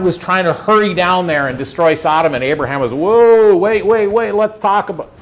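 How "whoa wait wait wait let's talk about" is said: high, loud mock alarm calling a halt; a hammed-up cry to stop someone rushing off. Abraham halting a God hurrying toward Sodom, in mock alarm.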